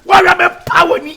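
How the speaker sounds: heated fatherly telling-off; angry scolding. Acted. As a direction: scold loudly, pitch climbing. Father raging in the quarrel.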